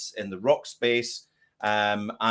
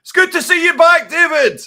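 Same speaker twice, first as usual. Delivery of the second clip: loud, high yell in broad Glaswegian; imitating a shouting Glaswegian fan; Glaswegian heckler's shout; 12 dB louder than usual. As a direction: bellow it from the crowd in a Glasgow accent. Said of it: "someone shouted in a very Glaswegian accent".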